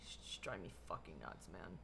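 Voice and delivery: sighing whisper of she's driving me fucking nuts; sighed whisper; exasperated whisper. Real, not acted. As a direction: let it out under your breath, worn out. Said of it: "exasperated about mom".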